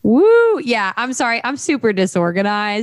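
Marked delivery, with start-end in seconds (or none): 0.0-0.6 loud, high-pitched "woo" cheer; excited whoop. Real, not acted. Co-host cheers next week's pick, excited whoop.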